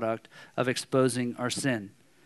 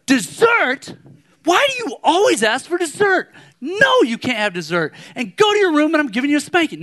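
loud, high-pitched hammed-up outrage; losing his mind; 12 semitones higher, 6 dB louder than usual. Hungry dad blowing up at son over dessert, hammed-up angry shout. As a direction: yell it in exaggerated exasperation. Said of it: "And I lose my mind".

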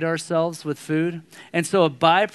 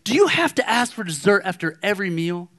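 exasperated scolding question, higher pitched; retold harsh tone; 4 semitones higher than usual. Hungry dad scolding son, retold harsh tone. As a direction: scold him, fed up and pointed. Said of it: "my harsh tone come out at my son".

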